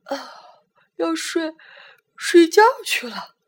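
yawning. A narrator's reading. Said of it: "打着哈欠说".

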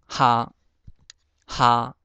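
哈 as "hā", level tone; Hokkien reading. Shown as a demonstration. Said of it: "最后一个夏".

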